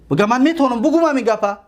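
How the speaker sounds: normal talking voice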